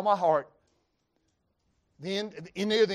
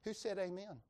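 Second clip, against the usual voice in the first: joking soft aside; 12 dB quieter than usual. Real, not acted. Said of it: "That was too loud".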